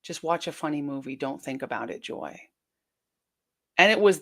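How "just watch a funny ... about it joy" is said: quiet retold advice. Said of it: "she told me".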